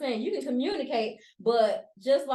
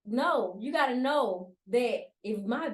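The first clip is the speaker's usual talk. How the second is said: ordinary talk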